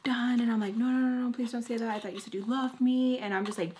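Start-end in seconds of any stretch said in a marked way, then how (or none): 0.8-3.2 retold pleading, higher pitch; begging. Girlfriend begging boyfriend not to end it, pleading. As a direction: plead in a rush of mock desperation.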